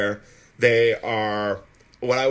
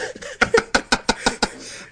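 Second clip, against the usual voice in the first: airy high-pitched laugh; high breathy laugh; 11 semitones higher than usual. Real, not acted. Laughing at own slip.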